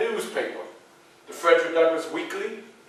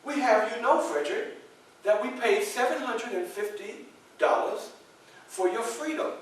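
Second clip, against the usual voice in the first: lady's news; 5 semitones higher, 6 dB quieter than usual. Retold or imitated. The lady announces his freedom.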